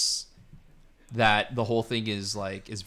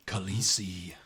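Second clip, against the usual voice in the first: hushed, lower whisper of Khaleesi; mock whisper; 4 semitones lower, 5 dB quieter than usual. Host joking about the dragon queen, mock whisper. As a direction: whisper the title softly. Mock breathy utterance of the title.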